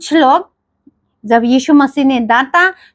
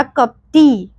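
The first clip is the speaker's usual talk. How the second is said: a hint of a stern scolding on कपटी; stern rebuke; much slower than usual. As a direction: say कपटी as a short stern reproach. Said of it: "गुस्सा से बोला". (कपटी पर हल्की कड़ी डांट)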